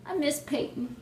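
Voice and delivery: quiet, pining fan voice; soft lament. Real, not acted. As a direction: say it small and wistful. Repeats it as softer lament.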